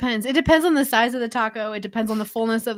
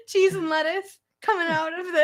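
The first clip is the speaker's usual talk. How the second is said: giggling through the words; trying not to crack up; laughing; 5 semitones higher than usual. Real, not acted. Laughing at innuendo.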